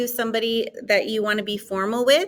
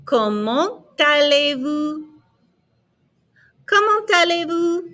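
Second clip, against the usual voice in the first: higher, drawn-out French teaching voice; repeat-after-me model; 6 semitones higher, slower than usual. Shown as a demonstration. Teacher models formal French for the class, slow and raised. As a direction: chant it evenly for learners to copy. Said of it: "répétez".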